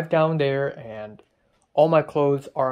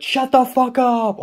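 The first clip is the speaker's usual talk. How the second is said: much louder and higher, slow furious yell; angry shout; 11 semitones higher, 8 dB louder, slower than usual. Retold or imitated. Yelling at the flipped slipper, furious shout. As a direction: bellow it at the slipper. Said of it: "shouted ... on top of my lungs".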